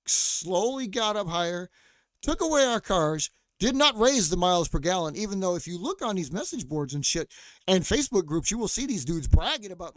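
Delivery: loud, building rant. Real, not acted. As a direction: pound it out in anger. Ranting about car makers.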